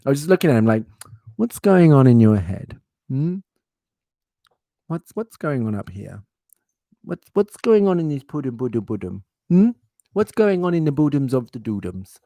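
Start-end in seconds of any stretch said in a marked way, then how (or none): 1.3-12.2 put-on sing-song baby talk with a cute lilt; cooing baby talk to the cat. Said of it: "I don't actually talk like this".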